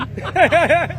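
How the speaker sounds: quick real laugh; short laugh. Real, not acted. Recalls hitting the wall.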